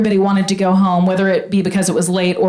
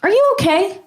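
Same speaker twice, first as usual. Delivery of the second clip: lightly voiced boyfriend; 10 semitones higher than usual. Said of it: "he kinda pulls back and he goes".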